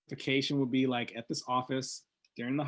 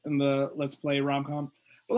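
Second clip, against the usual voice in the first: ordinary delivery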